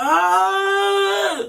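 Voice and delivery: long, high mock scream; imitated shout. Showing how Chips was shouting, high imitated scream. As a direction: hold a wordless imitation yell up high. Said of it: "She's shouting, shouting, shouting".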